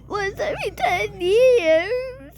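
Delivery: high, wailed question; whiny sobbing echo, rising at the end; pretend tearful dismay. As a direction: cry it back in mock disbelief. Scared one wails it back.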